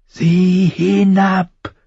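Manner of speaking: high, very drawn-out command; insistent crow. A narrator's reading. Crow repeats order to frightened boy, insistent. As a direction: stretch the order out, high and unyielding.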